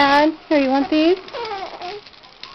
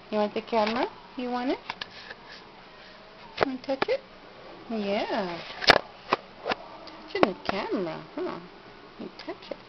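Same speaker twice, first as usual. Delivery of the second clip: very soft hushed coaxing; whispering; 5 semitones lower, 19 dB quieter than usual. Real, not acted. Hushed talk to baby.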